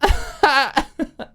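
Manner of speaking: high rising tipsy giggle; giddy drunk laugh; drunken laugh. A narrator's reading. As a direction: laugh loudly at your own joke. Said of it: "She laughed at her own joke".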